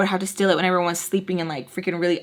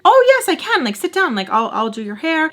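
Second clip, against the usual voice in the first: mock eager; 8 semitones higher, 3 dB louder than usual. Eager reply to Mel's request.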